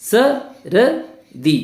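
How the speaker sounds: सर्दी broken slowly into separate sounds: sa, ra, dee; syllable by syllable. Shown as a demonstration. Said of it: "एक बार फिर से बोलिए". (सर्दी को अक्षर तोड़कर, धीरे-धीरे बोलें)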